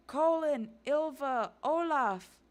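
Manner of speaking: loud, high calls, one name each; calling out. Acted. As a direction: holler each name as if searching. Group running, calling names.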